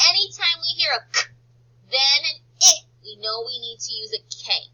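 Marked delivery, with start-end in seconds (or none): none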